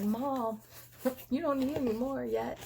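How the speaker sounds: laughing as she teases; daughter teasing, laughing. Retold or imitated. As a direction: chuckle through a gentle scolding. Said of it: "She's like".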